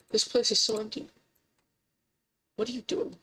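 usual speaking voice

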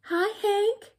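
high, loud sing-out greeting; chirpy tumbleweed hailing someone; cheery calling out. A narrator's reading. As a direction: call out brightly to a friend, high-pitched. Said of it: "Rosie the tumbleweed called out".

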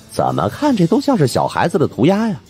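ordinary delivery

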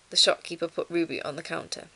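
ordinary delivery, nothing marked

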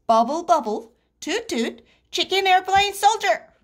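rising, high-pitched chant; swimming drill. A narrator's reading. Froggy recites the swimming drill.

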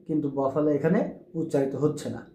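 usual speaking voice

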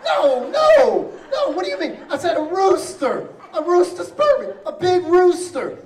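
high, loud, laughing protest; indignant protest. Retold or imitated. Patient correcting the nurse, loud high indignant protest. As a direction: squeal it out in outraged disbelief.

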